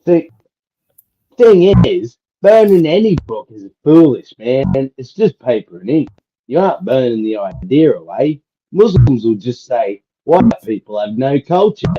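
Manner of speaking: put-on broad Australian accent, reading aloud; Aussie accent. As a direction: read it like an Aussie bloke. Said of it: "read it in my Aussie accent".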